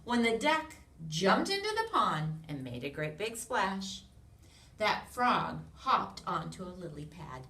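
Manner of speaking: loud, lively storybook read-aloud; animated picture-book narration; reading to children. A narrator's reading. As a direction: read it big for a room of little kids.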